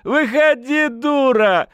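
high, loud tipsy holler; rowdy, demanding shout. A narrator's reading. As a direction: yell for her to come out. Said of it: "крикнул парень".

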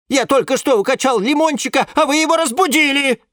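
high, loud indignant complaint. A narrator's reading. Lemon scolding the plants for waking his little lemon, indignant. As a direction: scold them for waking the baby. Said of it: "возмутился Лимон Филимон".

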